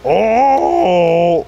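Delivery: high, bright shouts of delight; excited. Real, not acted. Reacting to the pirate call.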